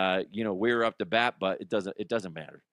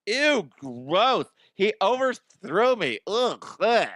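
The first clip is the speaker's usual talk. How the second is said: put-on higher, snotty kid voice; mock the judgy little kid; disgusted kid voice; 8 semitones higher, 4 dB louder, slower than usual. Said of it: "He's like".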